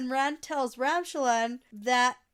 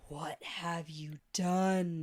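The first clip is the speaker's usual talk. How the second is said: slow, low and hushed; a horrified gasp of a whisper; 6 semitones lower, 5 dB quieter, much slower than usual. Acted.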